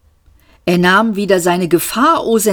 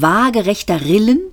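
ordinary delivery

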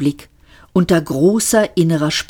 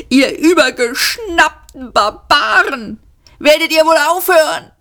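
hoch und laut hinüberbrüllen; brüllend; 11 semitones higher, 4 dB louder than usual. Acted. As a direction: den Abrissarbeitern zornig zubrüllen. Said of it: "brüllte Arthur". (wütendes Brüllen, hoch und laut)

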